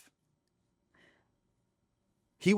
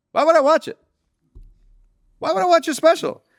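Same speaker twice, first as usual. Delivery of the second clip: very high, loud incredulous outburst; mock indignation; 7 dB louder than usual. [name] asked to watch the comedian's special, loudly indignant. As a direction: throw the question back in comic outrage.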